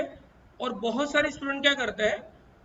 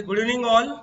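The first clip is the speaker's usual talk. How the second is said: louder repeat; 7 dB louder than usual. Real, not acted. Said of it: "अरे क्या कुछ आवाज नहीं आ रही।".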